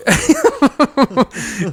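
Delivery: high helpless laugh bursting out; real laughter bursting out. Real, not acted. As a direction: crack up at the joke. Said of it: "Stone Cold Buck Angel".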